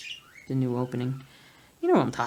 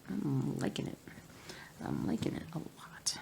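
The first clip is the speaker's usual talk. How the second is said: whisper; 12 dB quieter than usual. Real, not acted.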